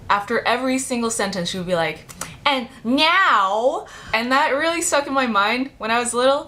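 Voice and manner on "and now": high, loud, drawn-out "now"; imitating a teacher who punches "now" up high and loud; teacher stressing now. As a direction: lean hard on "now" like a teacher drilling it. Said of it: "really emphasized the word".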